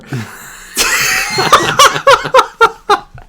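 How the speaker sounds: long, high, breathy laugh; high wheezing laugh. Real, not acted. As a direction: lose it in a squealing laugh.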